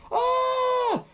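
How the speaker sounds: very high mock scream; imitated scream. Creature pops out of the bushes, mock scream. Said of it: "he's screaming".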